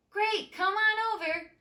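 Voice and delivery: high, loud cheerful call; eager invite. Acted. Molly inviting Gertie over through the tin can, eager. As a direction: sound delighted to invite her.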